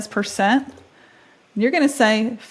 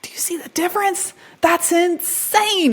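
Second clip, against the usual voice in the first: amazed; 10 semitones higher than usual. Real, not acted. Hearing the better voice clone.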